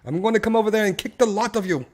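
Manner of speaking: amateur mock-British threat; British accent. Shown as a demonstration. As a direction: demonstrate a put-on British accent. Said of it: "the British accent".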